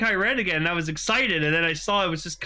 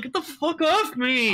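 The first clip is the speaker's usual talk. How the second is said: high, put-on grumbling shove-off; voicing Stacy brushing off a hug; grumpy Stacy; 7 semitones higher than usual. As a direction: snap it like an annoyed teen. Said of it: "And he's just like".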